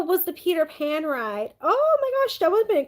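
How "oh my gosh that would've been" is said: high, excited oh my gosh; excited gasp. Real, not acted. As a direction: squeal with delight. Sees the Peter Pan ride bag.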